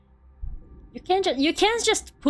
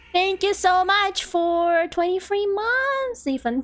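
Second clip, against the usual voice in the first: high, slow grateful thanks; sweet thank-you; 8 semitones higher than usual. Real, not acted. Thanks a subscriber.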